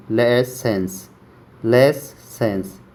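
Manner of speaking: लेसेंस drawn out slowly, the स held long; teacher sounding out लेसेंस slowly; slow syllable demo. Shown as a demonstration. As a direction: break लेसेंस into slow parts. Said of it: "यहाँ तक याद है".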